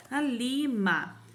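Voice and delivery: "A lima" stretched slowly, syllable by syllable; teacher slowly sounding out "lima"; slow syllable demo. Shown as a demonstration. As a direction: sound out "lima" for small children. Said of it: "Li, o L e o I".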